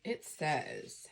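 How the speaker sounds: usual speaking voice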